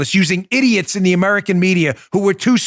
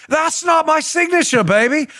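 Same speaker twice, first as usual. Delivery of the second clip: Austin Powers; 9 semitones higher than usual. Retold or imitated. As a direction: shout the denial like a groovy spy. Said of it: "they're like".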